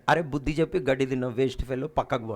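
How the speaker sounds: low, fast scolding voice of God. Retold or imitated. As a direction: rattle off God's rebuke, low and quick. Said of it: "దేవుడు అంటాడు".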